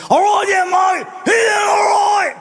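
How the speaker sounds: put-on high, slurred drunk-pirate rasp; raspy drunk Australian. Third Australian joining the chat, raspy drunken voice. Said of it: "He had more of a raspy voice".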